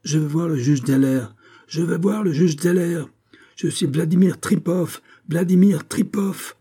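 high-pitched, insistent ranting; frantic yelling. A narrator's reading. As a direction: holler each demand like a raving man held back. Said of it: "hurla l'énergumène". (cris aigus et insistants de forcené)

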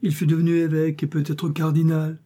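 high, plaintive servant's lament; lightly voiced whining regret; almost tearful. A narrator's reading. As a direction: lament it with a touch of tears. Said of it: "dit Bazin presque larmoyant".

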